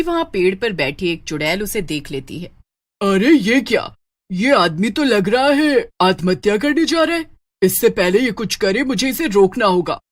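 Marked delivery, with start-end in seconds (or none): 3.0-4.0 louder witch voice, taken aback; startled witch. Acted. Voices the witch noticing Raj. 4.3-10.0 witch voice thinking aloud; put-on witch, concerned and resolving to step in; alarmed witch.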